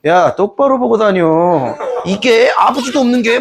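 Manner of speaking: 아주 느리게 빈정대는 불량배 말투; 느릿느릿 비웃으며 시비 걸기; 조롱하는 불량배. Acted. 대소가 주몽을 조롱. (주몽에게 시비 거는 대소처럼, 아주 느리게 빈정대며)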